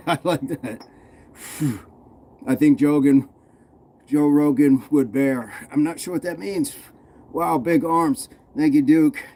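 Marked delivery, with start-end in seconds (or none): none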